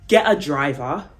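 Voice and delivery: ordinary delivery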